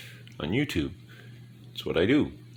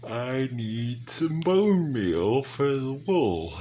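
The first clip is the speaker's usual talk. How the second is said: words stretched through a big yawn, higher and louder; 8 semitones higher, 4 dB louder, slower than usual. Real, not acted. Tired gamer yawning mid-sentence while crafting, slow. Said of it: "Big yawn".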